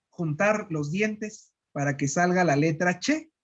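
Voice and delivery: normal talking voice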